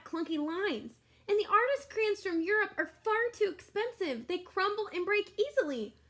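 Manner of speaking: put-on high, whiny voice of complaining kids; complaining children. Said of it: "They said".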